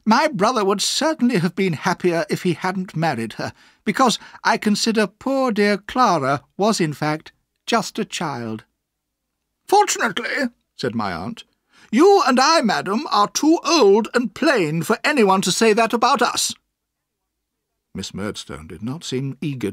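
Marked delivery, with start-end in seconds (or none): 0.0-8.6 high, falsely polite voice; false smile. A narrator's reading. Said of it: "smiling falsely". 9.7-10.5 high, loud retort; aunt's pitched-up comeback; tart aunt. A narrator's reading. Aunt retorts to Miss Murdstone. 11.9-16.5 high, loud, cutting retort; emphatic, withering comeback; tart aunt. A narrator's reading. Aunt retorts to Miss Murdstone.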